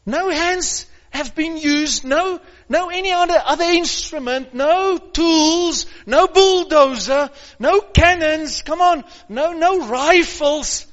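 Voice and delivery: loud, high fervent preaching; preaching at full force. Real, not acted. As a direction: drive it home with fire.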